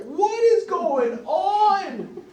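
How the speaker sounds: put-on high, loud, very slow child voice; bewildered child. Toddler baffled by a stranger's hug, high drawn-out child voice. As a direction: stretch it out in baby confusion. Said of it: "And the child's like".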